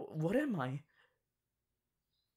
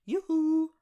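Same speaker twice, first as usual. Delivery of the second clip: high, loud sing-song yoo-hoo; calling out; 10 semitones higher, 5 dB louder than usual. Acted. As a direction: call out to get attention. Calling out to unresponsive listener.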